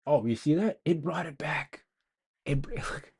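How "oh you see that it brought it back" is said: ordinary talk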